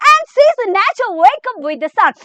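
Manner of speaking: very slow, word by word English reading; dictating to a class; slow note dictation. Acted. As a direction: read the notes out slowly for students to copy. Reads English notes aloud.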